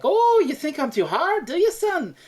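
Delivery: high, loud, fast mocking sergeant; defensive sergeant. Sergeant defending his harsh teaching to the kid, high taunting voice. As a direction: needle the boy, pitch way up. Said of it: "The sergeant's like".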